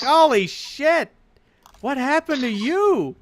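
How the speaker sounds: high, loud, drawn-out astonishment; shocked exclamation. Acted. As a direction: recoil in acted shock. Guys see the filthy party.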